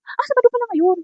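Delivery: high, surprised "oh, it's Saturday" lift; suddenly remember it's Saturday; sudden realization. Retold or imitated. Vlogger realizing the market is closed, surprised.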